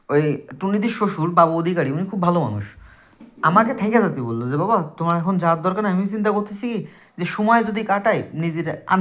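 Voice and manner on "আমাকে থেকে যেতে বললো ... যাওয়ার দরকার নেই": usual speaking voice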